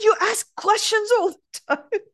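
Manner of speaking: high, mock-upset complaint. Young woman accusing the speaker of endless questions, imitated upset. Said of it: "She was so upset with me".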